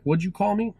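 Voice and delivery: a hint of an angry principal; angry demand. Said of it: "he's like".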